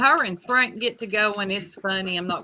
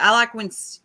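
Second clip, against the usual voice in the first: ordinary talk, nothing marked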